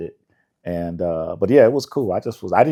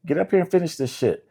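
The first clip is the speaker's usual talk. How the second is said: higher, pushy mock scolding; imitating bossy producers; 9 semitones higher than usual. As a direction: rib him like a boss telling him to get off the couch. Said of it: "It's like".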